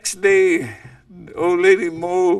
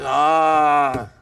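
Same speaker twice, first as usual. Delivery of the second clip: dawning realization; 3 semitones lower, 3 dB louder than usual. Acted. Coyote realizes how to cross.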